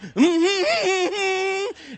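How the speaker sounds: loud quavering squeal; mock her whimper, high and long; teasing imitation of a whimper; mimicked high whimper. Man mimics girl's whimper.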